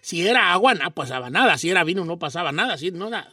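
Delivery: a hushed, dramatic parable read to students; hushed story narration. A narrator's reading.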